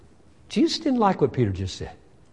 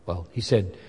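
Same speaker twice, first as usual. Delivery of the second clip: ordinary talk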